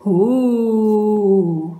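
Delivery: one long, level "Oh" held out; drawn-out oh. Acted. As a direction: stretch the "Oh" like the whole group saying it together. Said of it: "en disant".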